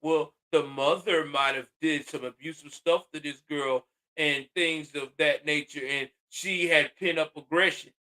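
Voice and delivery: mock the imagined critic; mock objector. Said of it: "someone out there probably say".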